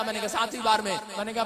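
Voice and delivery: normal talking voice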